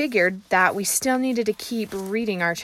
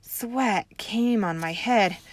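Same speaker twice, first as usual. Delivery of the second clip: ordinary delivery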